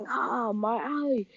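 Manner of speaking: high, drawn-out wail, kept soft; mock pained scream. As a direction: act out screaming in pain without volume. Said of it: "Sludge was screaming".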